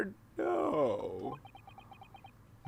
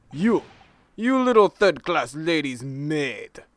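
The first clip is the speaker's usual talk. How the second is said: angry shout; 9 dB louder than usual. Acted. Sailor insults the player.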